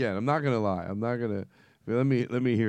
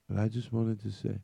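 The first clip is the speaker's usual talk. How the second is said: usual speaking voice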